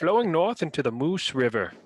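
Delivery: a bad Canadian accent dropped midway. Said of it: "I started trying to do a Canadian accent".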